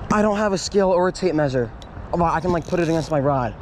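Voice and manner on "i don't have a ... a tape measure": usual speaking voice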